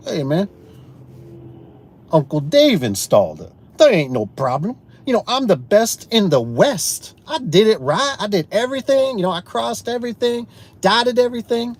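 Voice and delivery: high-pitched, hammed-up boasting; mock cockiness. Acts out boastful thoughts to himself.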